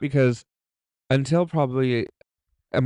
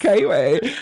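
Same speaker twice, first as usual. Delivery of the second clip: put-on Kiwi accent; 7 semitones higher, 7 dB louder than usual. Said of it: "You sound".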